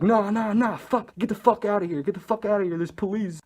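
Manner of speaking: high, pleading mock freak-out; high-pitched repeated urging; imitated panic. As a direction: imitate a paranoid friend urging everyone out. Retold friend freaking out about police.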